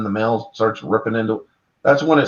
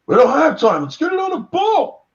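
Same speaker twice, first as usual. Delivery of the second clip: high, rushed mock panic; panicky soldier; 3 dB louder than usual. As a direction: blurt it out in a panic, pitched way up.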